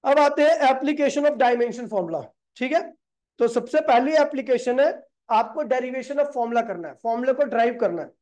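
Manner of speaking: ordinary talk, nothing marked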